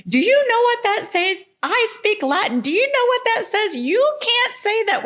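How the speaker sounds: shrill mock outrage at them; scolding lady. Shop owner imitating the angry Latin-speaking lady.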